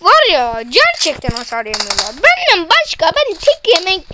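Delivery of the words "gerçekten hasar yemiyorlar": usual speaking voice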